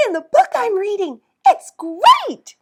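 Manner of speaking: high, loud, excited squeal; high gleeful shouted reply; yelled. Acted. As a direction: shout with joy while running. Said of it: "yelled Ellen".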